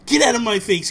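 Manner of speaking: exasperated parent, acted out; mock angry dad. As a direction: imitate a fed-up dad telling a kid off. Retold angry dad sending kid away.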